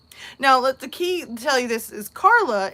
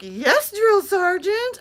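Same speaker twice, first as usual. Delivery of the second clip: high, loud, drawn-out sarcastic reply; mock-obedient, rude; 10 semitones higher, 5 dB louder, much slower than usual. Hotel worker being rude to a drill sergeant, mock-obedient. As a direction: stretch it into exaggerated, insolent obedience. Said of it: "being complete and utter poop head".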